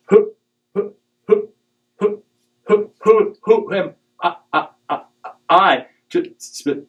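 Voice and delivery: high, stammering Moses with repeats; stuttering Moses. Retold or imitated. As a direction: stammer out every word as Moses. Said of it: "Moses is a stutterer".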